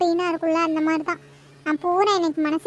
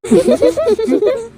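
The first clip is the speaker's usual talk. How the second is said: bright rising giggle at a friend; teasing laugh; 4 semitones higher, 5 dB louder than usual. Real, not acted. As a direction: laugh at her crush.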